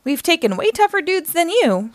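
high-pitched cocky retort; defiant taunt. A narrator's reading. Casey answers Lord Dreg, defiant taunt.

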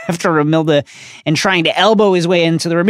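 ordinary delivery

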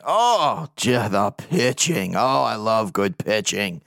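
slow, drawn-out gushing; savouring delight. Real, not acted. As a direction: linger on it, relishing every word. Gushing over the team's pitching.

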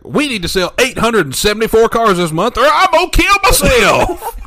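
rising to a high, loud frantic wail; mock desperate dealer. Imitating the dealer about his sales goal, frantic. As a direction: panic like a dealer missing quota. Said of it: "He's like".

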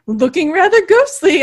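high, loud attempt at British; hammed-up British woman's voice; bad British accent. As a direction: try a British accent and fail. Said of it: "woman with a British accent's".

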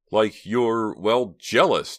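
slow, drawn-out well, jealous; blurted accusation. A narrator's reading. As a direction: hesitate then let jealous out. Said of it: "Terry let it out".

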